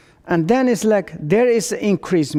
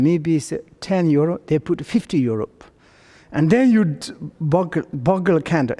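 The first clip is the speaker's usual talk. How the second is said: ordinary delivery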